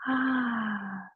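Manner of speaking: long, soft, falling "ah"; relieved sigh. Shown as a demonstration. Meditation leader letting go of past and future, relieved sigh. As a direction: let out a slow sigh of release.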